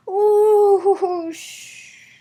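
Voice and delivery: loud high ooh then hissed sh; loud high ooh cut to a hiss; startled ooh. A narrator's reading. Water hits fire again.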